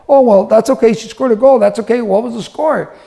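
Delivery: put-on high, cheery voice for consoling a child; upbeat reassurance. Dad consoles daughter after a goal, upbeat reassurance. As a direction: brush it off brightly for the kid.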